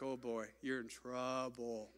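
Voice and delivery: low, soft and slow, like a knowing warning; lightly voiced foreboding, dropping the voice; a hint of spooky doom, said slowly; mock ominous. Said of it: "I was like".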